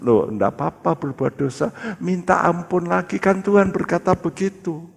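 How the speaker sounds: high-pitched mock excuse. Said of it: "Tapi ada orang Kristen". (menirukan orang Kristen yang menyepelekan, nada tinggi)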